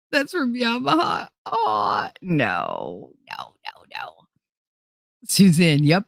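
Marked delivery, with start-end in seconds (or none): 1.5-4.3 falling mock no. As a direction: voice dropping low, fading out. Mock dismay, dropping away.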